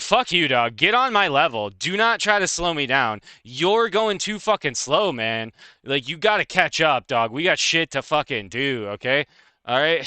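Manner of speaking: fired-up, pushy rebuke; worked-up scolding, drawing out key words; really upset. Acted. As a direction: hammer the words in an indignant comic rant. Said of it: "I will get really upset".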